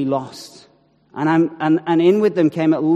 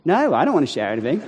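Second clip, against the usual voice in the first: quick refusal; 3 semitones lower, faster than usual. Retold or imitated.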